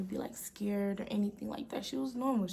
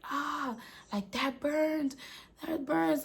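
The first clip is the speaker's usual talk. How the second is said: possessed pained cry; 6 semitones higher, slower than usual. Retold or imitated. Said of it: "It's like".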